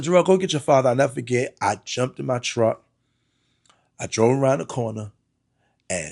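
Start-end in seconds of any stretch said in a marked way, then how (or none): 0.0-0.9 mom's order, louder and firmer; quoting mom, pressing harder; louder insistent mom. Retold or imitated. Mom sending her son for his father, louder insistent. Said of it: "She said".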